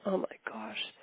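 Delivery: breathless whispered oh my gosh; a stunned inner whisper; whispered dismay. Retold or imitated. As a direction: hush it out in alarm. Alarmed thought about horse.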